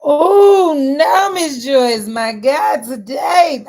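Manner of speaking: loud, high, tearful exclamation; emotional cry. Real, not acted. Calling out to Miss Joyce, crying out loud. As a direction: cry out, voice full of feeling.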